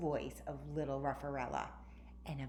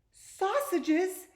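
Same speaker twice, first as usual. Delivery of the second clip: much higher, louder, rising yelp; excited surprise; 10 dB louder than usual. A narrator's reading. Hungry dog spots sausages on the queen's plate, thrilled. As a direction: leap at the word with greedy joy.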